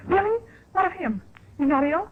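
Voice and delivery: high, worried questions; wife alarmed at news of her husband; anxious. Acted. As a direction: fear for him rising.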